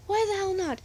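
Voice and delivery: high-pitched acted challenge; exasperated demand. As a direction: shoot the question back, pitch jumping. Friend demands answer in quarrel.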